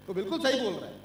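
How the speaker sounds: ordinary talk, nothing marked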